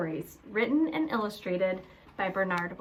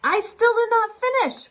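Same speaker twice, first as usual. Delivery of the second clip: high, loud, exasperated outburst; a bear at the end of his patience; frustrated cry; 7 dB louder than usual. A narrator's reading. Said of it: "Boris cried".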